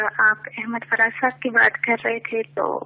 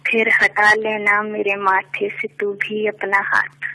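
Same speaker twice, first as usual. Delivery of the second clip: measured poetic recitation, lower; stately nazm recitation; 5 semitones lower, faster than usual. Acted. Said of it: "ایک نظم پیش کرنا چاہوں گی".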